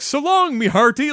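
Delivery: high Mario voice, pirate twang; Mario pirate line. Retold or imitated. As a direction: quote it like a cartoon plumber pirate. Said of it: "sounded like you talk like a goddamn pirate".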